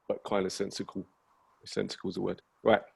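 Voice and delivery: ordinary talk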